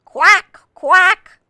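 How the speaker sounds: high, loud quacks; duck quacking. A narrator's reading. Reader asks what a duck says, high loud quacking. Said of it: "called the duck".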